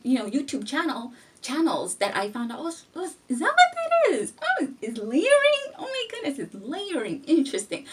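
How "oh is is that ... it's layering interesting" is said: high, squealing mock surprise; acting out an amazed discovery; mock amazement. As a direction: gasp like it's brand new news. Retelling discovering the name online.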